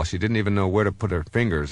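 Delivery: ordinary talk, nothing marked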